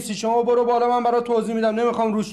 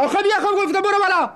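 angry brother shouting his order; exasperated yell; 8 semitones higher than usual. Acted. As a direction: lose patience and shout it again.

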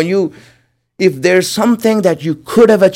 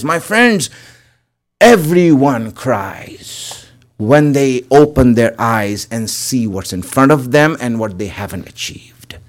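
usual speaking voice

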